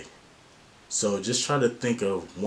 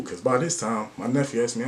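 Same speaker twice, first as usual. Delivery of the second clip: usual speaking voice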